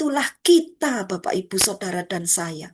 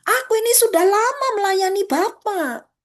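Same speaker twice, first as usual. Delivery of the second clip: indignant son; 6 semitones higher, 4 dB louder than usual. Acted. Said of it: "jawab anak itu".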